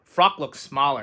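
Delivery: ordinary delivery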